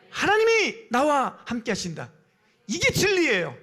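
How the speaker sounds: loud, high-pitched declaration. Real, not acted. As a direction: proclaim it slowly and forcefully. Preacher repeats the proclamation. (높은 소리로 힘차게 선포)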